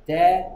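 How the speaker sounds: 데 hit loud and strong; stressed syllable. Shown as a demonstration. Teacher showing a stressed vowel's strong sound, emphatic 데. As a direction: punch out a firm 데. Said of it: "강세를 받으면 센 소리겠죠".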